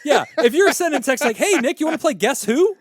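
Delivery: high, loud, cracking up while teasing; laughing mockery. Real, not acted. Joking with co-hosts about a lame text invite, laughing mockery. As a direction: tease loudly through real laughter.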